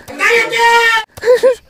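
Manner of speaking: high crying scream of cállate. Real, not acted. Gamer losing a fight, high anguished scream. Screams during game defeat.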